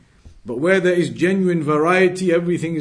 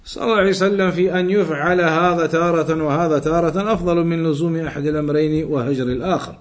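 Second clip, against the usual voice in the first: brisk, raised reading of a classical Arabic passage; reciting Arabic text; 3 semitones higher, 4 dB louder, much faster than usual. Acted. Teacher reading the Arabic text aloud to students, brisk and raised. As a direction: read the book out quickly and firmly, like a lesson text.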